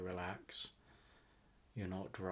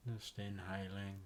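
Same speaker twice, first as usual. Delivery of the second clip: guided meditation; 3 dB quieter than usual. Acted. Host guiding listeners to relax into a rainforest visualization, guided meditation. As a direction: ease them into relaxation, phrase by unhurried phrase.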